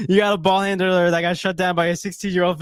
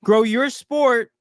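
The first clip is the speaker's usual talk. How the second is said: slow emphatic; 3 semitones higher, 3 dB louder, much slower than usual. Real, not acted.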